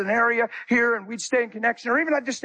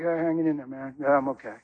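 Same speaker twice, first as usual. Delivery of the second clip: low, mumbled mock sigh of a tired traveler; a touch of defeated traveler; mock weary reply; 7 semitones lower, 3 dB quieter than usual. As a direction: lower and drag it like you're worn out. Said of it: "I'm not like".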